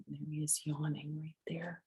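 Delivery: soft breathy whisper. Real, not acted. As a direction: whisper it like a secret. Hushed aside, kitten yawning.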